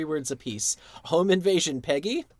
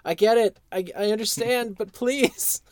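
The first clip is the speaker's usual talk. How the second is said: higher, louder begging please; pleading Hank; 7 semitones higher, 5 dB louder than usual. Retold or imitated. Hank begging Buck to see grill patio, pleading higher. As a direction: beg him to look, a bit higher. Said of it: "He's just like".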